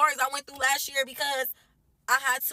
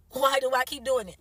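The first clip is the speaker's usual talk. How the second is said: usual speaking voice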